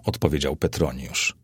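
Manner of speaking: plain reading voice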